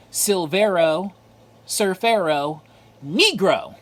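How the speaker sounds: loud, drawn-out mock-Spanish announcement. Collector showing off a comic's title to viewers, mock Spanish. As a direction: say each word big in pretend Spanish. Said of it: "as they say in Spanish".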